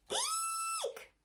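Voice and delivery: very high frightened squeal. Acted. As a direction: shriek in terror. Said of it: "squealed the bear".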